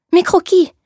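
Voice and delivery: gasping out mes croquis in alarm, pitched high; alarmed cry. A narrator's reading. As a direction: seamstress crying out on seeing her sketches, high and loud. Her sketches found by the clerk.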